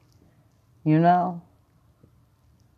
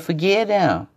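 impassioned plea; 11 semitones higher, 5 dB louder than usual. Retold or imitated. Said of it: "and he said".

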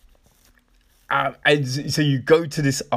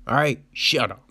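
ordinary talk, nothing marked

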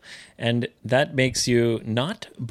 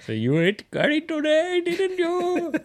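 put-on Sri Lankan accent. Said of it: "スリランカ出身で". (甲高いスリランカ訛り)

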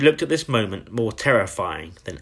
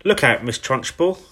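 louder, higher warning cry; 4 semitones higher, 5 dB louder than usual. A narrator's reading. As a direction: call out a schoolgirl's warning. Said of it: "cried Lavender".

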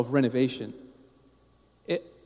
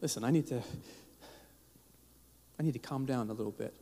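quiet, quick words to himself; calm yourself down under your breath; hushed, hurried self-talk trying to hold back emotion; muttered self-talk; 4 semitones lower, 13 dB quieter, faster than usual. Retold or imitated. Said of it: "I'm like".

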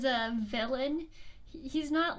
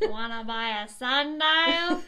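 slow, louder shady peddler pitch; film line; 6 dB louder, slower than usual. Pretend. Host asked to redo the movie line, slow shady vendor. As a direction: sell it slow like a sleazy street vendor. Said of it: "do it again".

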